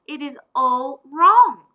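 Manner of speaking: loud and slow, every word weighed; a loud, slow groan of disappointment; dismayed emphatic line. A narrator's reading. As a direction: drive it home that it's hopeless. Narrator's dismay at the failure.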